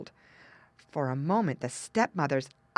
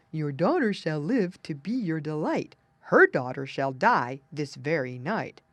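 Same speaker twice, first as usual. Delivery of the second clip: lightly voiced dog prophecy; a hint of bark in a chanted rhyme; barking little dog; 2 semitones lower, 3 dB louder than usual. A narrator's reading. Little dog under the table, lightly barked saying. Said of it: "began to bark, saying".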